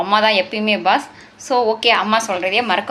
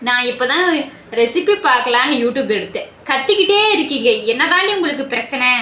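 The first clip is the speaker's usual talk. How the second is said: exasperated scolding; 4 dB louder than usual. Acted. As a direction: complain loudly over being disturbed. Acted quarrel, scolding the shouting. (கடுப்பில் உரக்கக் கத்துதல்)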